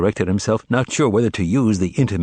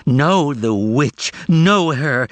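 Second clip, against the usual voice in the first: high, drawn-out howl; 6 semitones higher, 4 dB louder, much slower than usual. A narrator's reading. Margarita digging her nails in his face, howling. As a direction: howl it in fury. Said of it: "Margarita howling".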